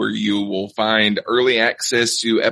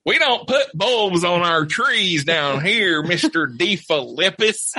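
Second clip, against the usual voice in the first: Southern drawl; 8 semitones higher, slower than usual. Pretend. Alabama, bulbs on trees down here.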